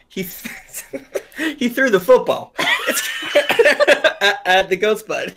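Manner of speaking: words broken by laughter; laughing so hard he can barely get it out. Real, not acted. Laughing through a funny story.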